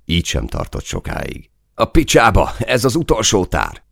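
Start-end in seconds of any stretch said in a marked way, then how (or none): 1.7-3.8 high, loud yelled curse; panicked yell. A narrator's reading. Soldier down to his last magazine, yelling. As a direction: yell out in alarm, pitched way up. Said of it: "ordította el magát Máté".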